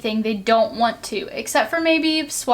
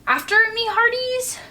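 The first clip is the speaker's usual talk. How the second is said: much higher pirate order; commanding pirate; 11 semitones higher than usual. Acted. Captain sends his crew after the ball, commanding. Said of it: "Braidbeard commanded".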